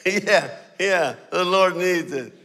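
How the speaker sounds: laughing sarcastic yeah yeah; mocking laugh. Owner laughing at the preacher's excuse, sarcastic. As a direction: scoff at the excuse through a laugh.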